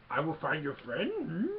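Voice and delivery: imitated little puppet creature with rising hum; Yoda. Yoda offering Luke help, imitated with a questioning hum. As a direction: quizzical creature voice, lifting at the end. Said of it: "Yoda's messing around with his stuff".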